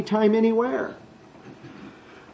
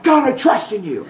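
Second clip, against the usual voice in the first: mock prayer; 4 semitones higher than usual. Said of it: "No, you trust in him by saying".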